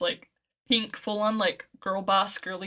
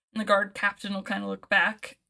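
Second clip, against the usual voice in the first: ordinary talk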